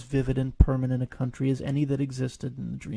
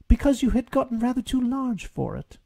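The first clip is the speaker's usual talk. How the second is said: higher, teasing rabbit; 10 semitones higher than usual. Acted. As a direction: needle the bear with a sly rabbit voice. Rabbit teases Pooh.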